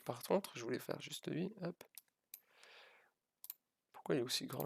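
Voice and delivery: normal talking voice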